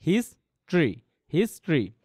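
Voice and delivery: "হিস্ট্রি" as two syllables, his-tri, falling; correct pronunciation. Shown as a demonstration. Teacher modelling history for students, correct two-syllable pronunciation. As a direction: say "হিস্ট্রি" twice, each in two beats. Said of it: "আর উচ্চারণ হবে কী হবে".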